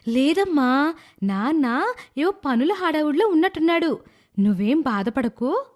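high mother's voice, soothing and slowing at the end; calm the child down gently; reassuring mother. Acted. Mother comforting her daughter after the father's scolding, gentle.

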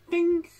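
squeaky high-pitched ding. Retold or imitated. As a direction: sing out a bright ding. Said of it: "I'm like".

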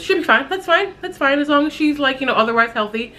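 chirpy high impression of mom brushing it off; imitating brisk mother. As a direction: toss off the reassurance like her mom would. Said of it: "my mother's immediate response was".

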